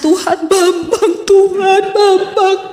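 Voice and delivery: high, drawn-out mock wailing prayer; pura-pura menangis. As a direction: wail the prayer in exaggerated fake crying. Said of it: "Saya kan tidak mungkin menangis". (pura-pura meratap dengan nada tinggi)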